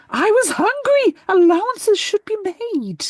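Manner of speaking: high, huffy protest from the Earl; indignant Earl. Retold or imitated. The Earl defends drinking from the teapot, huffy. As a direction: plead hunger like an offended nobleman. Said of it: "Earl's defiant reply".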